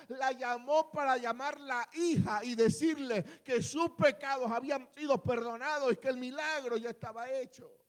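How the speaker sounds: loud, driving sermon voice; fiery preaching. Acted. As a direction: preach it to the whole congregation with force. Preaching at full volume. (predicación enérgica y fuerte)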